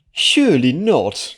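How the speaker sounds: slow, high aghast drawl; complaining. Acted. Unicorn mare complaining to nobles, high and aghast. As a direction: sniff it out in disdain.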